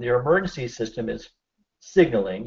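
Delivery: usual speaking voice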